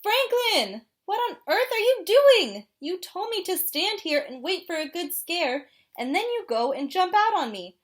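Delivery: high, loud squawking complaint; outraged penguin scolding; indignant penguin voice. Acted.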